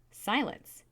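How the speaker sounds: commanding shout; sharp command. A narrator's reading. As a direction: cut the child off forcefully. Mother cuts off wailing son.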